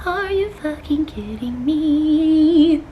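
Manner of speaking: drawn out disbelief; slow incredulous. Retold or imitated. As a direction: stretch each word in disbelief. Incredulous retort.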